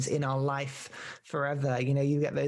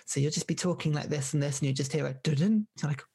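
ordinary delivery

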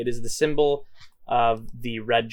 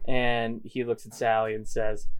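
ordinary talk

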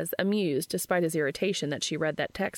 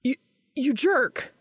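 slow, louder sputtering indignation; 7 semitones higher, 5 dB louder, slower than usual. A narrator's reading. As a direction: splutter it out, caught off guard. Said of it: "he sputters".

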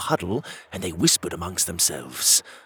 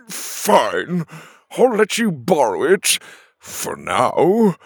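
grudging villain; 7 semitones higher, 4 dB louder, slower than usual. Acted. Bok Bok gives in grudgingly.

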